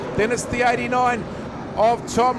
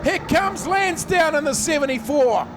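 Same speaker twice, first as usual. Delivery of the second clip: motorsport commentator; 3 semitones higher than usual. Real, not acted. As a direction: shout the last lap home. Final lap live call.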